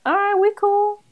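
high-pitched, casual dinosaur voice; dinosaur talking. Acted. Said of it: "the dinosaur thing is like".

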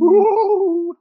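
high, loud cheer; excited whoop. Real, not acted. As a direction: whoop with glee. Cheering the news segment start.

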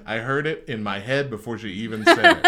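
ordinary talk

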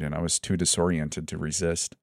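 usual speaking voice

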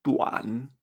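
TUAN in dipping third tone, drawn out; pronunciation demo. Shown as a demonstration. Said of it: "Esto se pronuncia".

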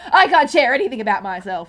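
loud mock outburst, half laughing; hammed-up exasperated wail; mum losing it. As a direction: imitate mum storming off in a huff. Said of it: "she loses her mind".

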